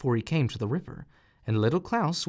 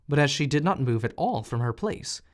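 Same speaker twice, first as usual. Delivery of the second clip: plain reading voice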